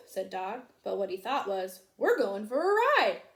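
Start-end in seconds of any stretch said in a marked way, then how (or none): 2.0-3.2 excited. A narrator's reading. Dog's thought voiced.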